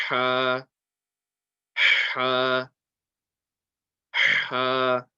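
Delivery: "ha" forced from mid-throat with a strong breath; powerful ha. Shown as a demonstration. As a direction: put real power into the breath of "ha". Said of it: "that's how the letter is going to sound".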